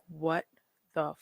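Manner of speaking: hushed what the fuck, then a squeak high up; baffled friend, voice jumping way up; mock shocked friend; stunned disbelief.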